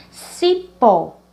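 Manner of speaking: "Cipó" in two beats, loud on the final "pó"; stress on last syllable. Shown as a demonstration. Teacher giving an oxytone example, last syllable stressed in "Cipó". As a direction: punch the "pó" at the end of "Cipó". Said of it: "ela é a mais forte".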